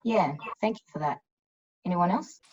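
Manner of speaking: usual speaking voice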